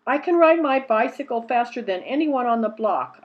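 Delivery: loud proud boast; boastful. Acted. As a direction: brag it out loud. Boastful girl to brothers.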